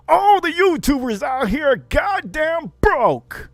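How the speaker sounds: high, loud and slow jab at broke YouTubers; aggressive rant. Real, not acted. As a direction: call them out hard. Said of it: "I'm getting aggressive".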